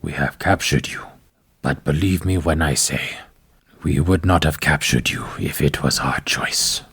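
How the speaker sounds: low, hushed menacing captor; low orc captor voice. Acted.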